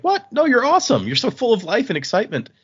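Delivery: high, emphatic disbelief turning to praise; retold excited "no way", pitched well up; enthusiastic protest. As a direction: burst out in eager reassurance. Quoted protest to her self-doubt.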